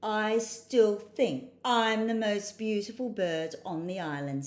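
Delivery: loud, drawn-out bragging parrot; boastful. A narrator's reading. Parrot boasting of being the most beautiful bird, loud and boastful. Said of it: "said the boastful parrot".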